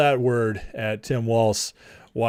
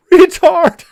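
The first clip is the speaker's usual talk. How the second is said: shrieked high and loud, laughing; a gleeful yell from a passing car; mock yell; 11 dB louder than usual. Imagined driver yelling out a car window, high mock shout. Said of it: "I just say".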